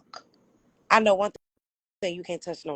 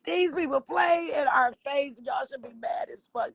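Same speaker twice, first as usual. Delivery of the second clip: fired-up outcry; 7 semitones higher than usual. Real, not acted. Fired up, rallying his listeners.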